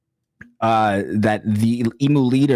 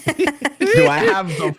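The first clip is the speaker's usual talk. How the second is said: mock formal; slower than usual. Storyteller mock-asks for the floor.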